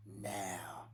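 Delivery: hushed, firm rebuke; whispered command. Shown as a demonstration. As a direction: order it low and certain. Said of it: "He's going".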